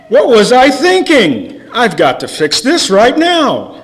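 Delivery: comic Yiddish God voice, laughing; hammed-up Yiddish fretting, rising high; yiddish accent. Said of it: "and said".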